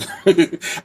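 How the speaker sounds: high real burst of laughter at the coward. Real, not acted. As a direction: laugh out, amused. Laughing at inmate under bunk.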